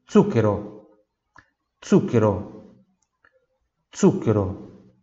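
zucchero with a sharp ts sound; harsh ts. Shown as a demonstration. Teacher drills the hard z in zucchero. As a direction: zucchero said with voiceless ts. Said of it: "áspera".

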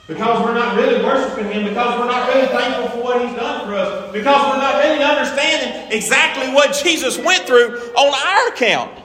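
high, loud shouted preaching; preacher shouting conviction at the congregation; preaching at full force. Acted. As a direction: build the sermon to a shout.

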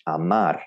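"amar" with two clear ah vowels; Spanish pronunciation. Shown as a demonstration. Teacher gives the Spanish word for love, "amar" with full vowels. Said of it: "the word to love in Spanish".

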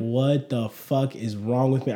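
slow, hushed self-reproach under the breath; muttered self-reproach. Retold or imitated. Alone in the bathroom, slow hushed self-reproach. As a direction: scold yourself quietly against the wall. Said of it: "leaned up against the wall".

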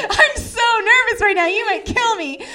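high, loud, giggly nerves; mock nervousness. Joking about danger at a strange guy's house, mock-nervous. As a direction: squeal it out nervously, half laughing. Nervous reply to a weed offer.

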